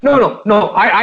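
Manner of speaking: normal talking voice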